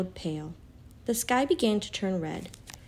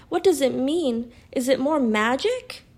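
raised, louder urgent questions; distressed cry; 5 semitones higher, 5 dB louder than usual. A narrator's reading. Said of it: "cried Susan".

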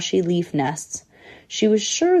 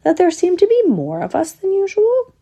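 put-on high-pitched old squirrel asking a question; high, puzzled elderly squirrel; 12 semitones higher than usual. A narrator's reading. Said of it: "said one elderly squirrel to his companion".

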